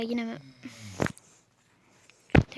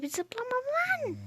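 high frustrated whine; 12 semitones higher, 5 dB quieter, slower than usual. Real, not acted. Character won't jump.

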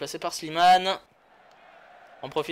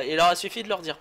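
usual speaking voice

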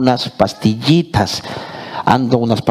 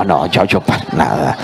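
ordinary talk

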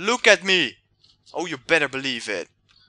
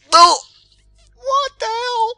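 high shriek of disbelief; startled high cry; shocked cry; 11 dB louder, slower than usual. Real, not acted. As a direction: yelp in sudden shock. Arm suddenly breaks in game.

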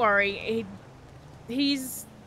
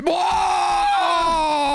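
long, loud wail of agony; drawn-out howl; pained scream; 3 semitones higher, 5 dB louder than usual. Pretend. Old man in agony, panic follows.

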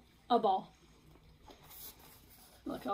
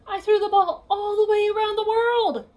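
higher, louder bragging; proud boast; 10 semitones higher, 10 dB louder than usual. Acted. Piggy bragging to Gerald about her throw, proudly. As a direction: puff up like a champion.

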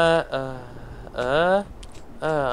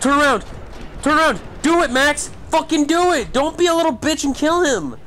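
high, urgent calls to a teammate; frantic urging; 8 semitones higher than usual. Real, not acted. Gamer pushing Max to kill the attacker, frantic urging. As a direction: egg him on in a raised, high voice.

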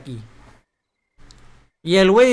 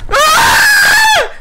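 very high, very loud scream; terrified scream; 11 dB louder than usual. Real, not acted. Streamer who warned he would scream, screaming. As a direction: scream in pure fright. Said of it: "voy a gritar".

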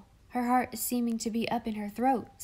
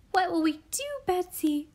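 high, louder tearful cry; 9 semitones higher, 4 dB louder than usual. A narrator's reading. Molly crying out to Betsy, higher and tearful. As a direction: cry out in fear to her silent sister. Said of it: "Molly kept on crying out".

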